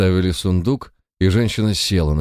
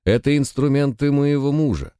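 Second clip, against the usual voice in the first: protesting cry; 9 semitones higher than usual. A narrator's reading. Wife defending her husband's tools, crying out in protest. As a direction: plead in a high, restrained cry. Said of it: "закричала она".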